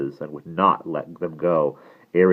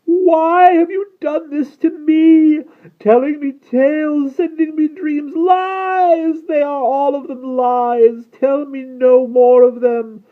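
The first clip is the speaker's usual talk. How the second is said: high, loud, anguished outcry; high-pitched raging lament; risen, trembling roar; 12 dB louder, slower than usual. A narrator's reading. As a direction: wail accusations at full voice. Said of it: "His voice rose and trembled".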